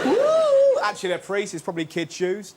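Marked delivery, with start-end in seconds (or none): 0.0-0.8 loud, very high "woo"; high-pitched whoop. Acted. Mimicking things floating in the air, high loud whoop.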